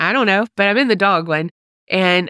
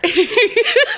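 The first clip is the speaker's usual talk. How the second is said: laughing; 12 semitones higher than usual. Real, not acted. Big laugh.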